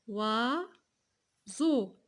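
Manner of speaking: "Oiseau" stretched as OI, long pause, SEAU; syllable by syllable. Shown as a demonstration. Teacher asking where z falls, "Oiseau" split in two. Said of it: "Début ou bien la fin?".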